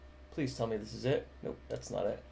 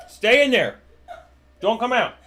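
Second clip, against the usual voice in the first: playful shout; 7 semitones higher, 6 dB louder than usual. Real, not acted. Teasing cook to keep cooking.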